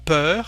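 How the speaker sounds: Peur with an open rounded "uh", rising; open French eu. Shown as a demonstration. Teacher contrasting peur with peureux, open French eu. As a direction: say Peur with the looser, open vowel. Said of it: "Can you hear the difference?".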